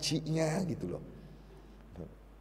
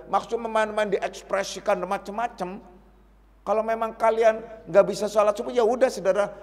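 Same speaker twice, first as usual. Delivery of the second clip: higher and louder, driving the point home; forceful preaching; 6 semitones higher, 5 dB louder than usual. Acted. Preacher scolds the congregation about hakikat, forceful and loud. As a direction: hammer the warning at the congregation.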